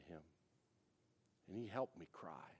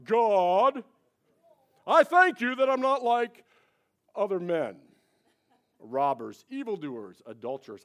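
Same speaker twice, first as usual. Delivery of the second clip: louder, higher, smug prayer; hammed-up Pharisee voice; 10 semitones higher, 20 dB louder than usual. Preacher mocking the Pharisee's boastful prayer, loud. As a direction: preen like a self-righteous man in church. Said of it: "I can't even try to talk like that".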